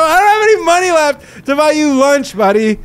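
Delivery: high, loud, near tears from laughing; laugh-crying retort. Retold or imitated. Mocking the scammer who wants lunch, high and laughing. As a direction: crack up as you say it. Retelling his reply to scammer.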